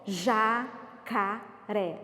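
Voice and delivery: JACARÉ very slow, each syllable held apart. Shown as a demonstration. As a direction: break "Jacaré" into three drawn-out syllables for counting. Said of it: "Quantas sílabas?".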